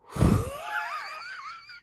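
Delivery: high-pitched, drawn-out wheezy laugh; wheezing laugh. Real, not acted. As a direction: laugh helplessly into a long squeak.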